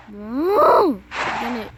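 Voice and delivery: ordinary talk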